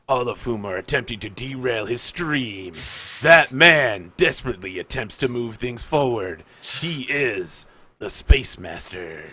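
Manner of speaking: movie trailer announcer. Acted. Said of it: "Can you do your best announcer voice?".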